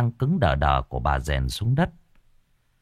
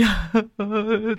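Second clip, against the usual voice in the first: sobbing wail; 13 semitones higher than usual. Acted. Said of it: "mếu máo".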